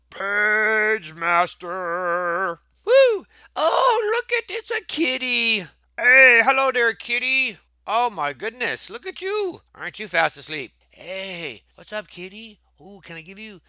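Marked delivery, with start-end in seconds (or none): 0.1-2.6 announcer drawl. Pretend. Dramatic reveal of game name. 2.8-9.6 cooing at kitty. Real, not acted.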